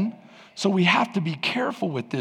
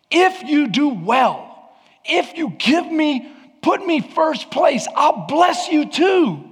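God pleading; 8 semitones higher, 4 dB louder, slower than usual. Acted. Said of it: "So the Lord said to Cain".

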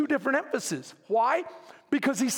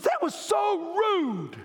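higher, slow, scolding wife voice; 4 semitones higher, much slower than usual. Retold or imitated. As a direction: play the appalled wife shouting at him. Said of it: "and she goes".

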